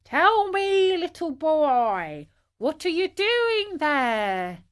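slow, high, drawn-out call; long stretched words, hailing from afar. A narrator's reading. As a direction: call down from far above. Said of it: "he cried to him".

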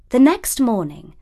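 plain reading voice

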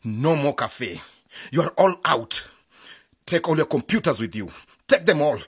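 loud furious orders; angry shouting. Acted. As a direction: shout them out of the cafe. Uncle shuts the cafe.

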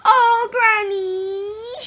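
high, slow crying wail. Acted. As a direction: wail to granny in tears. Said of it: "she wailed".

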